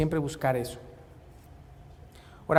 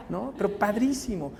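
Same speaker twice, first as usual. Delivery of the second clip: normal talking voice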